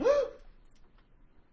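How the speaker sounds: sharp high gasp of fright; startled gasp. Shown as a demonstration. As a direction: gasp loudly like a startled child. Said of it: "But you're gonna".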